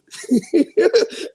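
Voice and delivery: real high-pitched giggle; high laugh. Real, not acted. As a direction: burst of high giggling at own joke. Laughs at own story.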